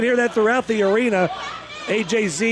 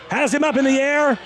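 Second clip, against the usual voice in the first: excited, higher play-by-play call; wrestling commentator; 4 semitones higher than usual. Acted. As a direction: call the big moves like ringside.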